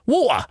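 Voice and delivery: "Water" as WAW-uh, t dropped; high-pitched "Water" with a glottal stop; London water. Retold or imitated. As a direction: swallow the t in "Water". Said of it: "And it's like".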